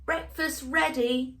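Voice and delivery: calling from the kitchen. Acted. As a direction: call it across the house. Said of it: "called Grandpa Power".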